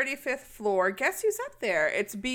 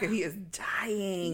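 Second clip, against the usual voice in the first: hushed whisper; 5 dB quieter, much slower than usual. Acted. Recapping the dying man to her co-host, whispered. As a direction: whisper it, breath only.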